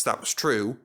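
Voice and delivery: plain reading voice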